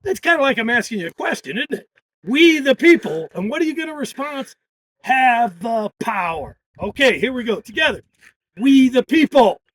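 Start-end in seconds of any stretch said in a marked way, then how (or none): 2.2-3.3 loud, higher, slowed call line; yelled call. Shown as a demonstration. Organizer calling out for the crowd to answer, yelling. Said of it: "I'm gonna yell, 'We the people.'". 5.0-6.6 demo response. Shown as a demonstration. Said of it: "you're gonna yell back at me". 8.6-9.6 loud, higher, slowed call line; yelled call. Shown as a demonstration. Organizer calling out for the crowd to answer, yelling. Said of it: "I'm gonna yell".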